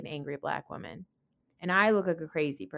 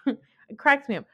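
ordinary talk, nothing marked

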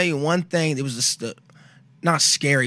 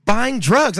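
higher, louder, deadpan sister voice; matter-of-fact sister; 8 semitones higher, 5 dB louder than usual. Retold or imitated. Sister explaining the Cuba vision, high deadpan. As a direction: state it plainly, pitched up.

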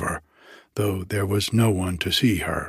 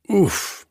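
sharp oof of pain; a quick hurt gasp; pained grunt; 9 semitones higher than usual. A narrator's reading. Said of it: "dropping the oars from pain".

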